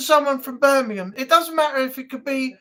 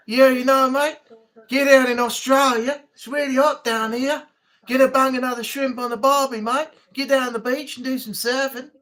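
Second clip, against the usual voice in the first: hammed-up Australian accent; Aussie accent; 3 dB louder than usual. Shown as a demonstration. Streamer showing off an accent about barbie and surfing, Australian accent. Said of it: "Aussie's all right".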